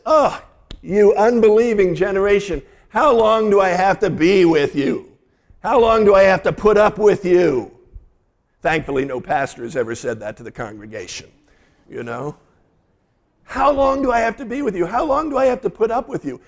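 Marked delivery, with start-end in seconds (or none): 0.1-7.7 high, loud exasperation at the end of his rope; Jesus fed up, voice raised and pleading; exasperated. Acted. Pastor voicing Jesus fed up with the crowd, exasperated. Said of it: "I want to say it with a little bit of emotion". 13.4-16.4 much higher, fed-up lament; exasperated. Acted. Pastor repeating Jesus' words to the congregation, exasperated. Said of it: "say it with a little bit of emotion".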